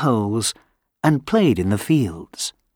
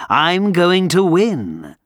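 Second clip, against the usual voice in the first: higher, slow and sure; gloating wind; 6 semitones higher than usual. Acted. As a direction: savour the coming win.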